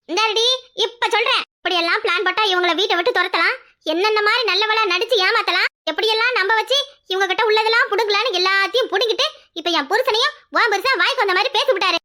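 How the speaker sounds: high-pitched, relentless tirade; angry accusation. Acted. Woman accusing her in-law of scheming, angry. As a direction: lay out every charge against her.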